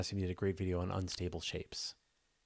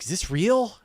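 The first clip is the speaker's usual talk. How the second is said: amazed; 9 semitones higher, 11 dB louder than usual. Retold or imitated. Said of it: "the person's like".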